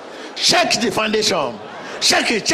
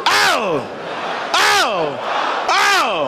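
shouting faith healer; much slower than usual. Acted. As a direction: command the demon out at full volume. Exorcism commands, repeated.

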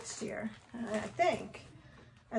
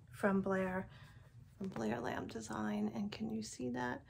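normal talking voice

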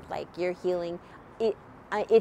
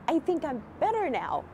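amazed; 7 semitones higher than usual. Retold or imitated. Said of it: "I had to say it again".

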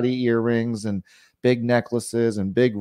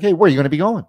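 high, loud, in-their-face questioning; pushy salesperson badgering a customer; constant pressure; 7 semitones higher, 5 dB louder, much faster than usual. Shown as a demonstration. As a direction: fire questions without letup. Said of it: "constant pressure in their face".

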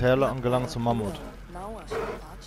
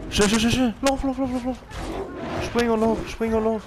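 high, rushed urging to run, much higher; frantic urging; 10 semitones higher than usual. Real, not acted. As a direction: spur the mount on in panic. Flieht panisch vor Mammut. (hektisch hoch antreibend)

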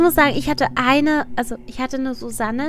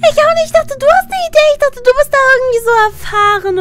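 much higher, loud squeaky protest; high excited protest; 11 semitones higher, 7 dB louder, faster than usual. Real, not acted. (hoher aufgeregter Protest)

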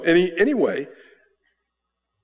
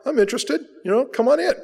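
eager contact's reply; 12 semitones higher, faster than usual. Retold or imitated.

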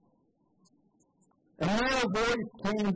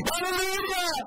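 high, ringing hallelujah cry; shouted hallelujah; 12 semitones higher than usual. Acted. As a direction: cry it like a praising multitude. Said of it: "That's what the multitude kept saying".

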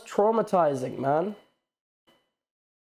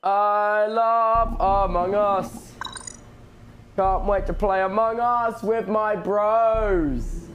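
very slow, stretched-out words; slow hammed-up devotion to the game; drawn-out fanboying; much slower than usual. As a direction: savor every word, dragging the vowels long.

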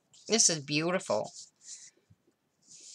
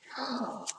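sharp gasp; 9 semitones higher, 8 dB quieter than usual. Real, not acted. Opening a surprise gift.

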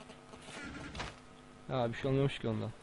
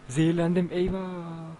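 mock alarm; 4 semitones higher, 6 dB louder, slower than usual. Real, not acted. Poisoned by zombie meat.

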